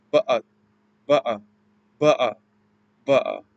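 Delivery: "Ba'a" with a glottal stop for the T; Cockney "Ba'a", a catch instead of the T; dropped T. Shown as a demonstration. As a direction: swallow the T in "Ba'a". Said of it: "the T sound".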